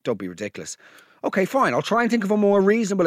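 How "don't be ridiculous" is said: dismissive low voice. Retold or imitated. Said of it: "he was like".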